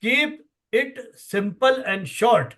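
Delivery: very slow, every word set apart; teacher spelling out an acronym at x0.3 pace; spelled out slowly. Shown as a demonstration. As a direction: lay out each word of the rule slowly. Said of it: "किस, ये नियम याद रखिए".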